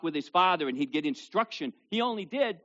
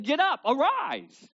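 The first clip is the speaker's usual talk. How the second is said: high, loud, slow command to rise; commanding call; 12 semitones higher, 6 dB louder, slower than usual. Retold or imitated. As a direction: call the dead boy up with power. Jesus raising the dead boy.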